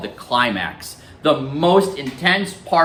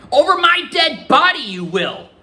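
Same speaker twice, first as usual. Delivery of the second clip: loud tearful shout, high pitch; yelling; 14 semitones higher, 3 dB louder than usual. Acted. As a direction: yell it like a grandmother near tears. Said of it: "That's Graham yelling".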